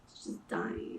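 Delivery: breathy whisper of "It's just dying."; a hushed, fading repeat; whispered echo. Real, not acted. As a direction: let the words die into a whisper. Echoing her own point softly.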